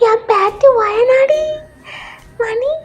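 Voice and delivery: high, drawn-out granny voice; high grandmother croon; doting grandmother. Acted. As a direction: coo about the granddaughter in a high old-woman voice.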